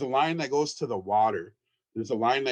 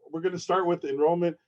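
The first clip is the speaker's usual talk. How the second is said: excited, louder delivery; eager presenter getting carried away; real excitement, voice raised; 5 semitones higher, 4 dB louder, faster than usual. Real, not acted. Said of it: "I'm excited".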